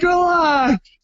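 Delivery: hammed-up calming of a nervous wife; mock exasperated relax. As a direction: stretch it out, telling a worrier to chill. Repeats his plea to nervous wife.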